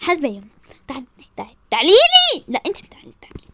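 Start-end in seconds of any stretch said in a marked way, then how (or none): 0.9-1.6 much softer beckoning; low goading. Acted. Woman dares rival to come over, quietly. 1.7-2.4 loud, high-pitched challenge; furious shout. Acted. Woman dares rival to come at her, yelling.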